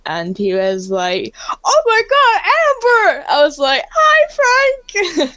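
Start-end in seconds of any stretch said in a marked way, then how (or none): 1.6-3.3 retold as a high, drawn-out excited cry; loud, high squeal imitating his delight; delighted greeting. Star greeting a fan on a video chat, delighted high shout. 3.9-5.3 loud, very high fangirl squeal, retold; excited greeting. Fan answering her hero on video chat, excited high squeal. Imitates own excited reply.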